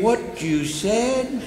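high, slow, drawn-out shock; gasping what-did-you-say; stunned disbelief. Acted. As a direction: hear the unthinkable and stretch it out. Shocked friend hears dog killed.

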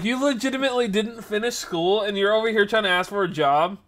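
high, loud disbelief; incredulous retort. Retold or imitated. Repeats his incredulous retort.

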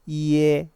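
이에 loud and held, 이 gliding into 에; pronunciation model. Shown as a demonstration. Teacher demonstrates 이에 to learners, loud and sustained. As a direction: project 이에 as one long level glide. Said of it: "으가 이하고 결합이 되면 에로 발음된다".

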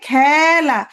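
খেলা with a long, open "æ"; open vowel. Shown as a demonstration. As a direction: slowly stretch "khæ" in খেলা. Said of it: "তাহলে এ".

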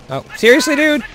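high, rising disbelief; a sharp seriously that jumps up at the end; incredulous. Real, not acted. Player gets killed again, shocked disbelief.

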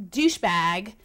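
usual speaking voice

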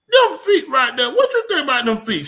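hamming up his buddy's loud question; retold loud question. Buddy loudly asks the pedicurist about his feet, mock shout.

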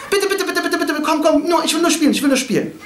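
put-on high, breathless-run childish pleading; Bahlul. The madman begs to play with the keys, childishly pleading. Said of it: "Bahlul, der ist so".

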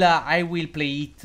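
usual speaking voice

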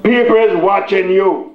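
high, emphatic pulpit warning; preaching. Real, not acted. Preaches to congregation.

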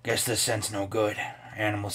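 low quiet mumbled voice; a lightly put-on low voice; odd character voice. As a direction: grumble it low under the breath. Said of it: "that's not a voice that you should have".

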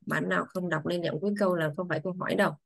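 ordinary talk, nothing marked

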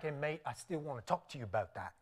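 quick, quiet mock Australian-English accent; low, hushed attempt at an Aussie or English mate; rough British-or-Australian accent, said fast and softly. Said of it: "Is that Australian or English?".

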